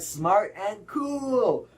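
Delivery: high, slow gushing praise. Acted. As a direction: gush over him. Exaggerated admiring greeting.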